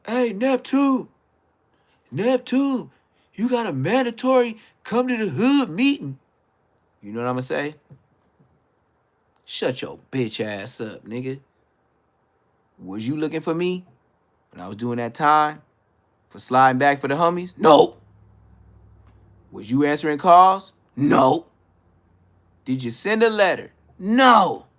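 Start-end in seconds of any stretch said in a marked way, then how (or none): 0.1-6.2 sing-out shouted summons, imitated; yelling out. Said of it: "if somebody yell out". 12.8-24.7 loud questions, each answered with a higher, louder no; loud, heated self-answered interrogation; indignant challenge. Real, not acted.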